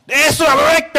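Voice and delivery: loud, very high cry; desperate shout. Acted. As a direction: yell it out in panic.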